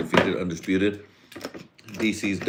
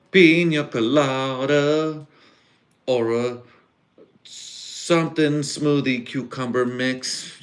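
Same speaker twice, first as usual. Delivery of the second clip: higher, louder and slow, sneering at the drink; mocking prissy drink order; 7 semitones higher, 4 dB louder, much slower than usual. Scoffing at fancy cocktails while telling the date story, mocking. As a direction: drawl it out like it's ridiculous.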